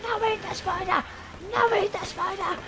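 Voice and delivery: very high, slow mimicked squeal; squealing child. Dad imitating daughter upset about the spider, squealing. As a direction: imitate a small girl squealing in horror. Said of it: "she squealed the house down".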